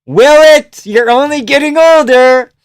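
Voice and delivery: high, loud heckling; loud taunt. Real, not acted. Heckling an aging fighter on screen, loud taunt.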